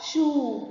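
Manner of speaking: "شو" stretched as a long shoo. Shown as a demonstration. Teacher letting pupils hear waw, "شو" drawn out long. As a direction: hold the oo in "شو" steady. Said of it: "سامعين صوت المد بالواو؟".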